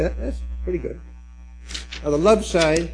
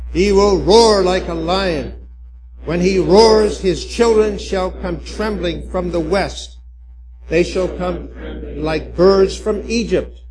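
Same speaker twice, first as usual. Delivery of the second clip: slow and much louder, triumphant; loud group proclamation; 4 dB louder than usual. Shown as a demonstration. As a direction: proclaim it together, ringing out. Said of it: "we'll all read that together".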